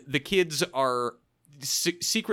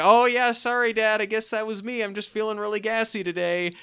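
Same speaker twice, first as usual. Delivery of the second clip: higher-pitched, sheepish kid imitation; mock apology, pitched up and offhand; Taran Noah Smith; 8 semitones higher than usual. Son covering for Bigfoot to dad, mock apologetic. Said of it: "Tara Noah Smith takes the blame for it".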